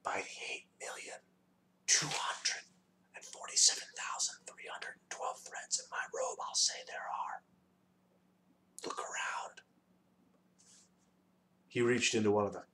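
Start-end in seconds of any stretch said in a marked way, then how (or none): 0.0-9.6 voiceless, hushed whisper; soft whisper. A narrator's reading. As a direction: lean in and whisper it softly. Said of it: "and whispered softly".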